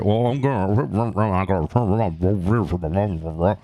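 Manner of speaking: slurred, drawn-out garble imitating the driver; mock incomprehensible bus driver; unintelligible driver. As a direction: mumble it into running gibberish. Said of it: "I have no idea what you just said".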